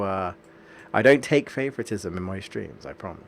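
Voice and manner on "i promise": usual speaking voice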